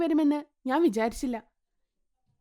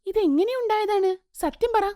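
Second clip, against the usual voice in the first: higher, startled demand; shocked sister; 7 semitones higher, 3 dB louder than usual. A narrator's reading. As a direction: gasp out the question in shock. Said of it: "നീലിമ അത് കണ്ട് ഞെട്ടുന്നു". (ഞെട്ടലോടെ ഉയർന്ന സ്വരത്തിൽ ചോദ്യം)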